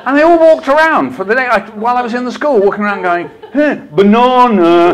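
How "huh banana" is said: loud, high, slow mock "buh-NAH-nuh"; mocking pupils. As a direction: jeer it like kids mimicking a British word. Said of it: "like that".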